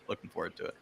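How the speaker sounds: breathy whispered aside. Real, not acted. Panelist whispering his own line again. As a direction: whisper it under your breath.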